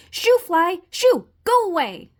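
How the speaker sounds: high, loud shouts; frantic shooing. Acted. Frog shooing fly from book, frantic. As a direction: chase it off with sharp cries.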